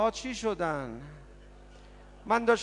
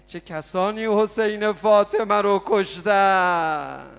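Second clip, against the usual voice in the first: rhetorical cry; 7 dB louder, slower than usual. Real, not acted.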